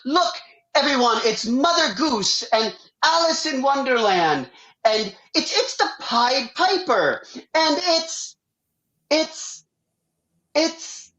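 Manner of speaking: mock announcer calling out the floats; parade announcer. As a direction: announce the floats to the crowd, then falter. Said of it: "an announcer announcing us".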